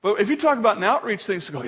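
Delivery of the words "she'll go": ordinary delivery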